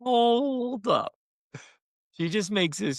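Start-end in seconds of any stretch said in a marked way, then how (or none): 0.0-1.1 high, loud playful halt; mock alarm from a parent; hold up. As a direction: stop everything, big and bright. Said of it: "I'm like".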